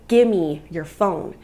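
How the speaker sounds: firm mock confrontation, drawn out; retold aggressive demand. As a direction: re-enact a forceful order. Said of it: "really aggressively".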